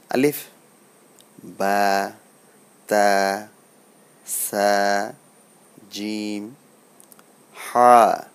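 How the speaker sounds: drawn-out "বা, তা, সা", each letter alone; Arabic letter pronunciation. Shown as a demonstration. Teacher modelling letters for learners to repeat, careful recitation of "আলিফ, বা, তা". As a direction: recite "আলিফ" short, then hold "বা" and "হা" long. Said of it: "আরবি হরফের উচ্চারণগুলো করি".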